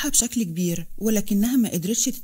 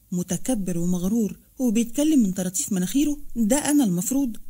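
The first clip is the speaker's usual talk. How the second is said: usual speaking voice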